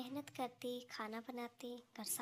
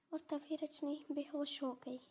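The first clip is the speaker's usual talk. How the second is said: usual speaking voice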